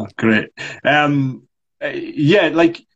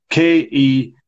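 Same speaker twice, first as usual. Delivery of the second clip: normal talking voice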